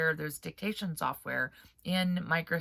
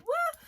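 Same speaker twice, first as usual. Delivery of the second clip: mock flourish whoop. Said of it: "giving it the old, you know, accent".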